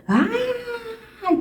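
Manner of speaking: high whimpering wail, soft; mock tantrum cry. Child refusing the bath, high soft whining cry. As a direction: imitate a toddler's crying whine.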